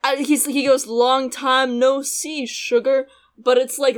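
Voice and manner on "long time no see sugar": drawn-out, failed Western line; really slowed down. Retold or imitated. Imitating the dubbed star greeting Mai, really slowed down. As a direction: imitate a botched cowboy greeting, very slowly. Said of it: "really slowed down and, like, not Western".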